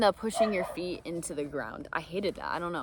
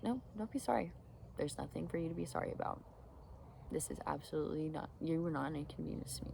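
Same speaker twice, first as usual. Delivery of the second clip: softer, gentle voice to someone apologizing; soft reassurance; 10 dB quieter than usual. Shown as a demonstration. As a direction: reassure them quietly, nothing to be sorry about.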